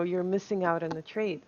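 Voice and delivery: ordinary delivery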